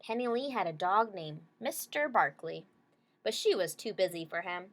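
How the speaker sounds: usual speaking voice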